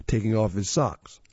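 ordinary delivery, nothing marked

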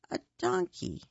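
mock his silly hope; mock surprise.

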